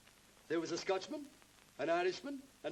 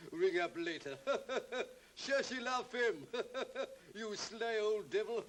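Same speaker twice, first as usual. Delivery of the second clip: chortling, drawn-out ribbing in a higher pitch; teasing laughter; 4 semitones higher, slower than usual. Acted. Friend ribs a man over his phone call, chortling knowingly. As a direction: laugh between the teasing phrases.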